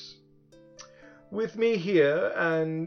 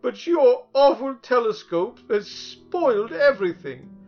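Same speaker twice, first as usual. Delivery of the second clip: high, loud tearful tantrum; hysterical crying; 10 semitones higher, 7 dB louder than usual. A narrator's reading. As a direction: wail like a crybaby demon. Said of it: "And crying hysterically".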